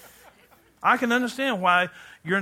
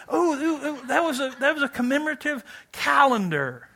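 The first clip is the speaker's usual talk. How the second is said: lightly cooing wife voice, higher; mock sentimental wife; 6 semitones higher than usual. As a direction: coo over a keepsake. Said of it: "Women remember everything".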